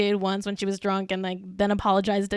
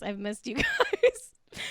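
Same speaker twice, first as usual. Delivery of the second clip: laughing fondly; 10 semitones higher, 3 dB quieter, much slower than usual. Real, not acted. Laughs mid line, slow.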